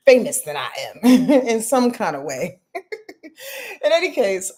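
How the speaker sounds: words broken by chuckles; real giggle through the joke; laughing while talking. Real, not acted. Laughing through self-deprecating joke.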